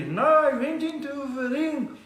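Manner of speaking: high, slow, drawn-out boxer voice; impression of Gerry Coetzee. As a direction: stretch each phrase out, high and laboured. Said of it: "How did it go, Gary?".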